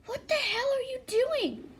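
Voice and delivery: very high, indignant outcry; pitched-way-up confrontational yell. A narrator's reading. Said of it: "then Uraraka yells".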